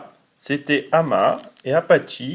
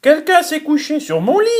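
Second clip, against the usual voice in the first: higher, quick mama bear voice; middle bear's voice; 10 semitones higher, faster than usual. Acted. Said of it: "de sa voix moyenne".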